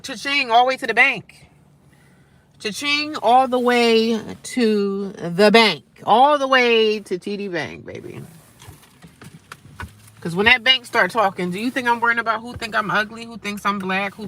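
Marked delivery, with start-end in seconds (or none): 2.6-8.2 slow, stretched-out words with weight; milk every word of the boast; drawn-out boast. Real, not acted. Same line repeated, drawn out.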